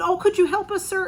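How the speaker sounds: high, pleading plea for help; asking a stranger for help, high and urgent; pleading call. Retold or imitated. Pleading to stranger mid-traffic.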